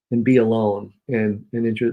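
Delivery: normal talking voice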